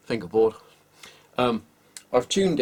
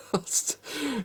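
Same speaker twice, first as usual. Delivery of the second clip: high laugh; 4 dB quieter than usual. Real, not acted. Laughs at the crunchy tuners.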